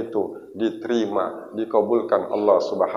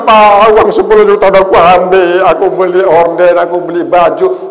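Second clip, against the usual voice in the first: loud, high mock sobbing confession; pengakuan sambil menangis, ditirukan; 8 semitones higher, 13 dB louder than usual. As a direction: wail the confession out, hammed-up. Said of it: "Pas nangis-nangis kan ku bilang". (meniru tangisan meraung saat mengaku)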